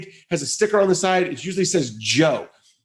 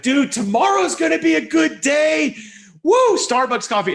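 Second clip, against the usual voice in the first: hyped, much higher voice; giddy coffee excitement; pumped up; 12 semitones higher than usual. Retold or imitated. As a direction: cheer yourself on like it's game day. Excited self-quote about Starbucks.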